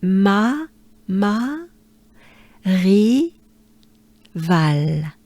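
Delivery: Ma, ri, val split into clear syllables. Shown as a demonstration. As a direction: build Marival one syllable at a time. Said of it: "but it's ma".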